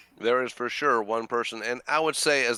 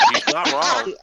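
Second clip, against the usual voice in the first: high, laughing, louder; 7 dB louder, slower than usual. Real, not acted. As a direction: laugh as you agree. Laughing agreement.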